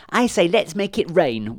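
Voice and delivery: high, eager and loud; gleeful young witch plotting mischief; excited witch voice. Acted. Silly young witch proposes mischief.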